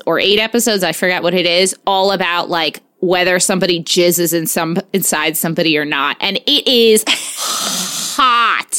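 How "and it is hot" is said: slow, drawn-out sultry purr; gross sultry hot. Acted. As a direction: stretch it out lewdly, way too slow. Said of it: "That was the grossest way to say hot".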